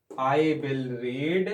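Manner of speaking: drawn-out teacher dictation pace; slow enough for students to write it down. Shown as a demonstration. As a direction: spell it out word by word, very slowly. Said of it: "मैं अमर Ujala newspaper पढ़ूंगा".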